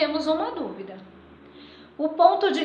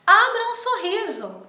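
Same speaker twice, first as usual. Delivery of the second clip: "Abra um sorriso" exclamado, alto e agudo; exclamação; 7 semitones higher, 4 dB louder than usual. Shown as a demonstration. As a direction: diga "Abra um sorriso" com entusiasmo, em tom de exclamação. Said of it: "substituiríamos o título". ("Abra um sorriso" com ponto de exclamação, animado)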